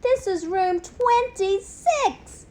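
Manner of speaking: high, slow squeak from the cage top; squeaky hamster. A narrator's reading. Hamster on top of its cage squeaks at the class. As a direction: insist in a tiny squeaky voice. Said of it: "my voice is small and squeaky".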